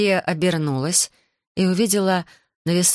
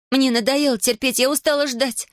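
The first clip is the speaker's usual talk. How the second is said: put-on higher, furious girl; angry outburst; 8 semitones higher than usual. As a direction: snap out in anger. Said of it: "В ярости".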